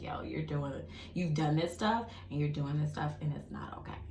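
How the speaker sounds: soft, hushed inner voice; quiet voice of conscience; whispered self-rebuke. Retold or imitated. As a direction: scold yourself under your breath. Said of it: "immediate conviction".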